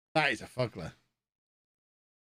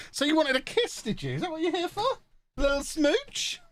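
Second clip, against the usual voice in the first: high, teasing kissy-talk; playful cooing; 13 semitones higher than usual. Pretend. Streamer teasing someone about a little smooch, playful high cooing.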